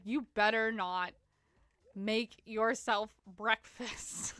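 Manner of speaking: ordinary talk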